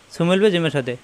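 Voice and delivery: ordinary talk, nothing marked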